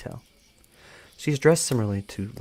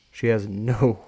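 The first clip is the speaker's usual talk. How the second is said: plain reading voice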